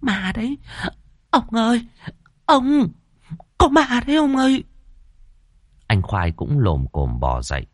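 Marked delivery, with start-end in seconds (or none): 0.0-4.6 high, frantic cries for the husband; shrieky, breathless woman in a fright; panicked. A narrator's reading. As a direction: gasp out the warning in terror. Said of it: "cô luống cuống chỉ tay".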